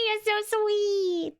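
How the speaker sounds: high and very slow, cooed out; cooing. Real, not acted. Streamer cooing back at a sweet chatter, high and slow. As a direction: coo it like melting at a compliment.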